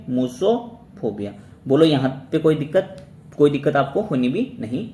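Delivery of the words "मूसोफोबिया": drawn out slowly, syllable by syllable; slow teaching pronunciation. Shown as a demonstration. Teacher to students, dictating the word slowly. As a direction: say it slowly so students can copy it. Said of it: "क्या बोल दोगे?".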